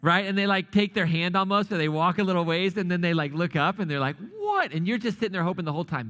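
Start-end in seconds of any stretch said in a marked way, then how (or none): 4.4-4.7 high-pitched startled "what"; mock shock of a mistaken kid; startled child. Kid to a stranger taken for a parent, startled. Child looks up at wrong adult.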